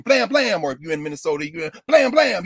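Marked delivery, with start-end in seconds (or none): 0.0-0.6 mock gunshots. Mimics police gunshots. 1.9-2.4 loud, higher gunshot noises; mock gunshots. Mimics police shooting.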